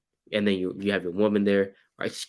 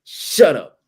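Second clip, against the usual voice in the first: sudden loud, higher bark; mock angry shout; 5 semitones higher, 9 dB louder than usual. Abusive husband silencing wife, mock angry shout. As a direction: snap it out like a bully husband. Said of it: "you kinda slap your woman".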